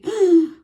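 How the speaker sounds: sharp loud gasp of wonder; a big astonished intake of breath; amazed gasp. Retold or imitated. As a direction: gasp as if stunned by a miracle. Amazed by God's perfect way.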